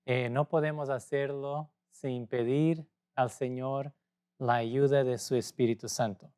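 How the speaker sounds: ordinary delivery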